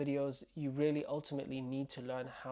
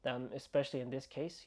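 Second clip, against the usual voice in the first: ordinary talk, nothing marked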